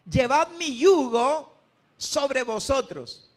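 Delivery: loud, slow, solemn proclamation; scripture proclaimed. Acted. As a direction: declaim the verse with full force. Preacher proclaims Jesus' words.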